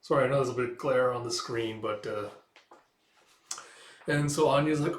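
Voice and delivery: normal talking voice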